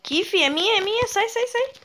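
rising high protest; possessive protest. Real, not acted. Claiming loot from friend. (protestando, é minha)

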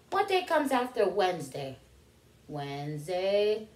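plain reading voice

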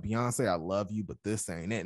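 low, softening voice of a let-down fan; disappointed reviewer. Retold or imitated. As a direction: imitate a reviewer letting the star down gently. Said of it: "She was like".

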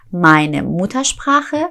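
slow, careful German; slow model word. Shown as a demonstration. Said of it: "slow repeat".

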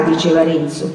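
ordinary delivery, nothing marked

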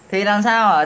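ordinary delivery

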